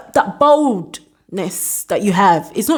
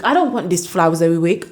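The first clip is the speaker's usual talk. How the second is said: ordinary delivery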